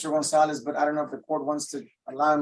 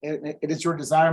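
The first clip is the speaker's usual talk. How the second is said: raised volume so the court can hear; speaking up; 3 semitones higher, 6 dB louder than usual. Real, not acted. Defense lawyer repeating for court reporter, speaking up. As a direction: project the question clearly, louder and higher-pitched. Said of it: "You're gonna have to speak up".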